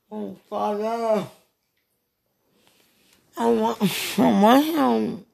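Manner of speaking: slow, high, drawn-out longing; overwhelmed wail. Real, not acted. Calling on Father while longing for a lover, wailing. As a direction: stretch it out high, overcome with desire. Overcome during intimate message.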